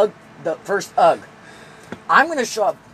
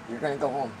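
mock Jedi mind control; 8 semitones lower, 10 dB quieter than usual. Mind control commands to soldiers.

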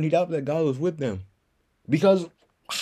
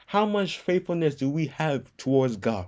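normal talking voice

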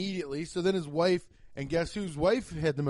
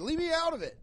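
much higher, squeaky imitation of the guy; high mock heckler; 12 semitones higher, faster than usual. The heckler quoted, high squeaky imitation. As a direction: squeak it like a flustered audience member. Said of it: "He said".

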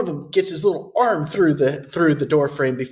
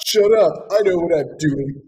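cocky Terry. Retold or imitated.

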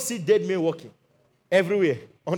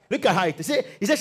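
ordinary talk, nothing marked

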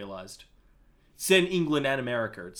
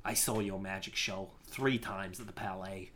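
put-on lower, softer Bostonian American accent; Andy Serkis, Bostonian; 5 semitones lower, 8 dB quieter than usual. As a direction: speak low in a Bostonian accent. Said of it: "kind of a Bostonian American accent".

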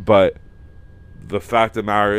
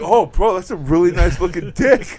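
mock dude voice; 9 semitones higher, 8 dB louder than usual. Retelling a show scene, mocking.

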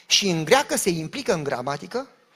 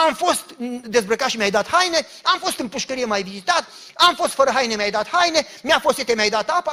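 loud, raised preaching quote; impassioned quoting; 4 semitones higher, 5 dB louder than usual. Acted. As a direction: build it in driving parallel phrases. Preacher quotes Jesus with rising force.

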